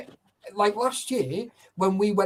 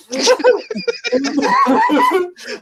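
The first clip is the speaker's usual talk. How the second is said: long laugh; 8 dB louder than usual. Real, not acted. Laughing at his own story.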